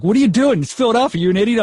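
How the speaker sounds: high, loud mock scolding; exasperated scolding. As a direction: imitate a mentor loudly telling someone off. Mentor scolds him in retold exchange.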